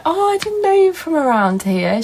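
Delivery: high-pitched mock surprise; imitated surprised woman. Streamer quoting woman at the shop, high imitated surprise. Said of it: "And she was like".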